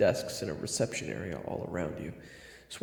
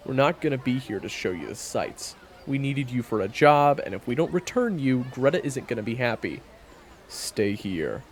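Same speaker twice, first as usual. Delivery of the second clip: stern scolding; 7 semitones higher, 7 dB louder than usual. Acted. Hunter scolds the kid.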